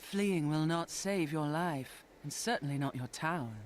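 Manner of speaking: haughty. Acted. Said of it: "they give a lot of sass to everyone".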